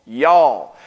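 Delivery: "Y'all" drawn out as one syllable, yawl; a North Carolina "Y'all"; Southern "y'all". Shown as a demonstration. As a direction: say "Y'all" as a Carolina native would. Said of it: "It stands for you all".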